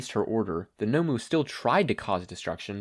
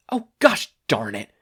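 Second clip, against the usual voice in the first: frustrated loud curse, character voice; cursing; 7 dB louder than usual. A narrator's reading. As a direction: blurt it out in annoyance. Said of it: "Kathleen cursed".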